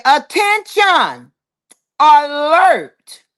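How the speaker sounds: slow, high, drawn-out proclamation; mock public announcement. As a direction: proclaim it word by word like an alert. Reads comment as public announcement.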